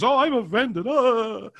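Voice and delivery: high, loud mock whine of someone offended; mocking offended activist. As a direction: sneer it like a whiny easily offended activist. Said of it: "you think of all these social justice warriors".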